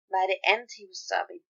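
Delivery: steady storyteller reading; calm narrator voice; story narration. A narrator's reading. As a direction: read it like a fanfic audiobook.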